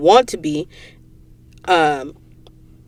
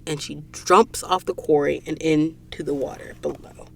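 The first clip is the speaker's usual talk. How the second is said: soft, hushed ending of the book; hushed whisper closing the story; 3 semitones lower, 10 dB quieter than usual. Real, not acted. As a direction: drop to a whisper for the last words. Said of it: "I know I just started whispering".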